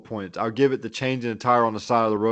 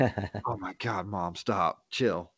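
low, soft, weary protest; slow and hushed, begging her to stop; mock embarrassed pleading; 4 semitones lower, 8 dB quieter, slower than usual. Grown child to mom quoting the movie, low embarrassed mutter.

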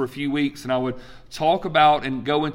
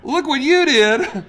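sarcastic echo; 10 semitones higher, 6 dB louder, slower than usual. Retold or imitated. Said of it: "I'm like".

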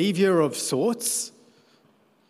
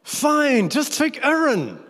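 much higher, exasperated fed-up sigh of a line; frustrated God; 12 semitones higher, 5 dB louder, slower than usual. Retold or imitated. God fed up with Moses' excuses, exasperated and high. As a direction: throw up your hands, fine, take him. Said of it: "That's how I read it".

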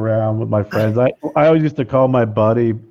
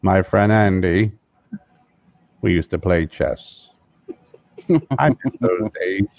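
lightly voiced slow, deep Morgan Freeman; slow, low narrator drawl of Morgan Freeman; a hint of Morgan Freeman, unhurried and low; Morgan Freeman impression; 3 semitones lower, slower than usual.

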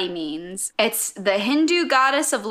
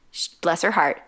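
ordinary delivery

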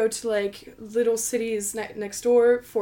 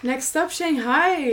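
slow, higher and louder playful echo; announcer voice; 6 semitones higher, 7 dB louder, slower than usual. Pretend. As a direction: announce it like a show host. Echoing the host's announcement.

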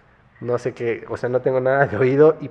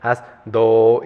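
patient teacher; 7 dB louder, much slower than usual. Retold or imitated. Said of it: "me dice".

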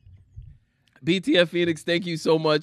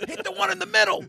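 acted cornerman. Acts out the boxing cornerman.

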